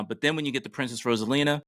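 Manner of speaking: ordinary talk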